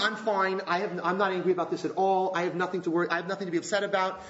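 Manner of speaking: ordinary talk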